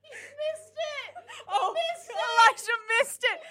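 high, loud, laughing and crying; laughing shout at the player who missed it. Real, not acted. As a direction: squeal it through laughter. Player teases a missed moment.

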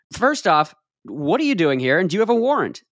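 ordinary delivery

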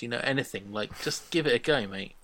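ordinary talk

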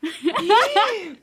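sharp high gasp of mock outrage; shocked gasp. Real, not acted. Friend exposing her secrets, shocked gasp. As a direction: gasp as if a secret was just spilled.

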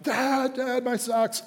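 put-on high-pitched child's whine; young son calling. Son calling his dad a second time, high and plaintive. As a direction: whine for Dad like a little kid.

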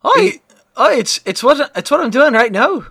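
put-on Irish accent. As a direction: stammer a defense in bad Irish. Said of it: "You have an Irish accent?".

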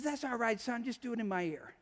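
very soft, easygoing murmur; hushed fatherly reply. Retold or imitated. Dad to little Johnny beside him in church, hushed. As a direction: quietly reassure the boy.

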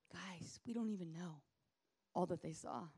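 whispered to the room; hushed aside. Real, not acted. Preacher confiding to the congregation in a whisper. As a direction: lean in and whisper the secret.

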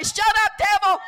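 high, loud preacher's rebuke; commanding rebuke. Real, not acted. Preacher leading the room against the devil, commanding shout. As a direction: order the devil out with full force. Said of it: "we need to tell the devil".

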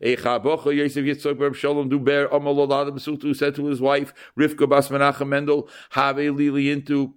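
monotone, chant-like recitation; formal reading without inflection; ceremonial document reading. Acted. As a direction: intone the date on one held pitch. Reads out the marriage contract.

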